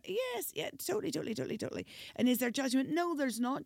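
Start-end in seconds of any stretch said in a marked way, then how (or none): 0.1-1.8 mock eager yes, jumping high; fake agreement. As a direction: overdo the agreement ironically. Ironic yes to counselling question.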